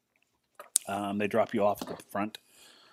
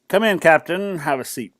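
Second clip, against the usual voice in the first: commander's welcome; 7 semitones higher, 9 dB louder than usual. Acted. Commander calls from behind the desk.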